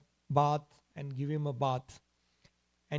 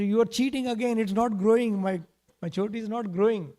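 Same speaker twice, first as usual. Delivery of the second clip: put-on higher, louder childish protest; indignant accusation; 6 semitones higher, 4 dB louder than usual. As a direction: accuse mother like a cheated little boy. Child accuses mother of cheating.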